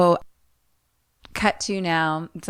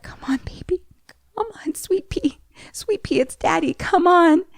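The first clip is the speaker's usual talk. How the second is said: gentle half-whispered pleading; tender coaxing; 10 semitones higher than usual. Retold or imitated. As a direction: coax a child awake in a hushed voice. Retelling parents coaxing the child.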